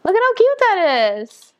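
high, gushing delight; excited gushing. Real, not acted. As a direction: squeal it out over something cute. Gushing over cute cup.

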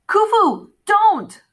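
loud, high-pitched warning shout; yelling. A narrator's reading. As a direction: yell to stop him. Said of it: "Carter yelled".

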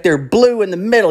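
high, indignant complaint; mock outrage in a high voice; imitated yelling. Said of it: "he's, like, yelling at".

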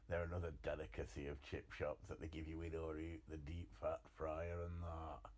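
put-on a weak, half-hearted Scottish accent; bad Scottish accent. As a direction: put on a poor Scottish accent for the chip shop line. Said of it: "that's a terrible Scottish accent".

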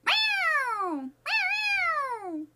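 loud high playful meowing; cat meows. Pretend. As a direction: meow like a happy cat. Playful cat meows after compliment.

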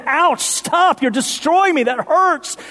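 high pained cry of the house. Acted. Preacher voicing the house under the jackhammer. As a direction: wail it like the hurting house. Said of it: "As they say".

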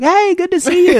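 high, smiling imitation of a cheery hello; friendly greeting. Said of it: "this very friendly smile".

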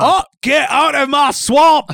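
high, loud Scottish ogre bellow; slow, shouted Scottish ogre impression; Shrek. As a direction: bellow it in a hammy Scottish accent. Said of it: "I like to say it's Shrek".

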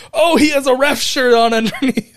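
high, loud burst of delighted surprise; excited realization. Acted. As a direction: blurt it out like a sudden discovery. Voicing a fan's sudden realization.

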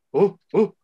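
short, high, loud "oh, oh"; high hooting call-out; imitated calls. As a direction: mimic a friend's high whoops. Imitates friend calling while waving.

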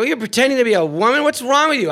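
high, incredulous rant; mock outrage. Blind man confronting the man pretending to be a woman, mock outrage. Retold quarrel in the sketch.

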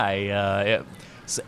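ordinary talk

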